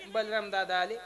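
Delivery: normal talking voice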